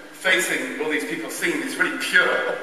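ordinary delivery

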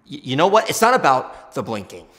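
suddenly loud, stammering start; loud flustered outburst. Acted. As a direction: blurt it out defensively, loud. Flustered outburst defending himself.

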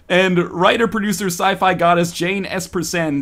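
high, slow, grand announcer; hyped-up celebrity introductions; game narration. Acted. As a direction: roll out each name with showy flourish.

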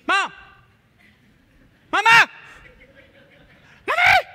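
put-on high, loud child yelling mama; kids calling out. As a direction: yell for mom like a small child.

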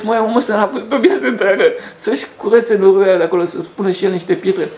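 lightly laughing mock of the neighbour; amused quote. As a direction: chuckle through the quoted complaint. Neighbour mocking the muddy yard.

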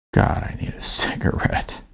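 breathy whispered craving, no voice at all; a weary whispered longing for a smoke; whispered aside. Acted. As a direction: whisper it to yourself like a confession. Narrator's craving, internal aside.

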